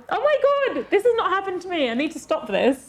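very high, gasping mock shock; high-pitched voiced thought of disbelief. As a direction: act out a stunned inner voice. Imagined listener's shocked thought.

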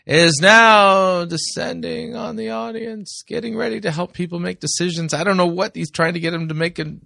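mock the holy moment with exaggerated awe; mock reverent. Mocking the manipulative sappy moment.

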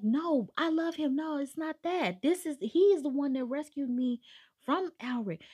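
high, urgent acted pleading; pleading protest. Retold heroine defending her lover to abductors, pleading.

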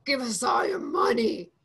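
slow, loud hammed-up demand; playful stick-up, punched word by word; mock demand. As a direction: demand cash from listeners in jest. Mock demand for listener donations.

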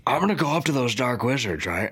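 normal talking voice

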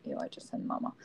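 quick whispered cringe; whispered aside. Real, not acted. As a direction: mutter it under breath, disgusted. Cringing aside at own word.

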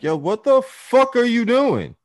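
high furious shout, slowed and stretched; yelling at friend. Retold or imitated. Yelling at his friend outside the club, furious shout. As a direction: scream it across the parking lot.

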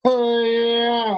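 high, long-held "yeah"; imitated rowdy cheer. Dad imitating the cheer in Walmart, drawn-out call. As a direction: mimic a cheeky drawn-out holler. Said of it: "He's like".

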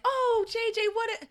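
very high-pitched imitation of a fan; mock gushing. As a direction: gush in a put-on high voice. Mocks gushing praise of J J.

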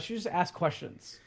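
normal talking voice

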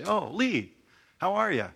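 lightly voiced friend calling out hello; greeting from heaven. Saint in heaven greets newcomer.

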